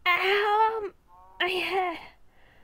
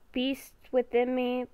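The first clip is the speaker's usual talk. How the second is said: normal talking voice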